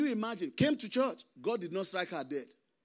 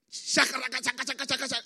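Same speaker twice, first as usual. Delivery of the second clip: rapid, higher-pitched mock tongues chant; imitated prayer shouting; 5 semitones higher than usual. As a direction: rattle off shaka syllables like a fervent shouter. Mimics loud shouting in prayer.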